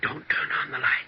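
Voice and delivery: urgent whisper. Acted. Ghost warns in the dark.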